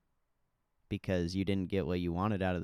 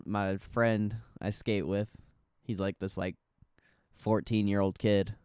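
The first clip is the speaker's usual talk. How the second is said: ordinary delivery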